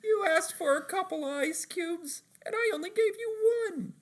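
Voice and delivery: a gleeful prankster explaining; SpongeBob voice. Retold or imitated. Retelling the April Fool's bit, SpongeBob's squeaky high voice. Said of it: "And he's like".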